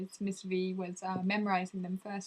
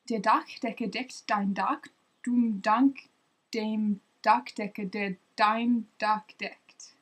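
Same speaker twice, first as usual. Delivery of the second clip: slow, careful German tongue twister; slow first try; 4 dB louder, slower than usual. Shown as a demonstration. First attempt at a German tongue twister, slow and careful. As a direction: sound out each word deliberately. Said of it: "I'll try and go faster".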